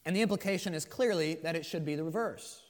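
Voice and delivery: usual speaking voice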